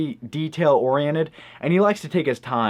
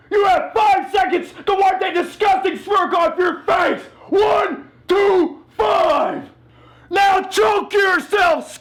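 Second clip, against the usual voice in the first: drill sergeant; slower than usual. Acted.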